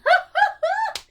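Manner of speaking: loud high shriek of laughter; old woman's cackling laugh. Acted. Great-great-aunt laughing loudly at the crashed copter. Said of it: "the old woman laughing".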